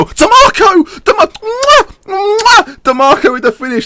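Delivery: wild commentator roar of joy; ecstatic goal shout. Real, not acted. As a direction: scream it like a last-minute winner. Late winning goal celebration.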